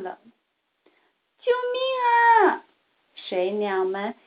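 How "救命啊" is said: high, loud frightened shout; panicked cry for help. A narrator's reading. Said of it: "惊慌万分地喊着". (惊慌地高声呼救)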